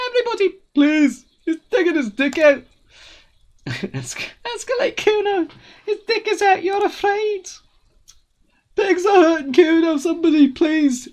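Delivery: false alarm shriek. Acted. Kids screaming to the neighbourhood, mock panic. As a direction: scream for the whole street to hear.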